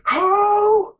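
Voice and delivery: high, drawn-out enraged yell imitating a friend; livid shout. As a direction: scream it like a furious gamer, stretching each word. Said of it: "Right after he shouted that".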